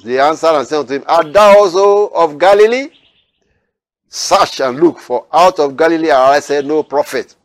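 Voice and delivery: loud, lifted Bible reading; preacher reading the Gospel aloud to his listeners, declaiming; scripture reading. Acted. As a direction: proclaim the verses to the congregation. Reads scripture verses aloud.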